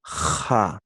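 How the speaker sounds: "Ja" with a long rasped fricative J; scraped J. Shown as a demonstration. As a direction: scrape the throat on the J of "Ja". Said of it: "pero raspada".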